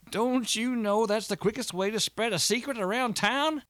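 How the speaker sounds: put-on high-pitched, scandalized old friend; agitated Walter. A narrator's reading. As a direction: scold in a strained high voice.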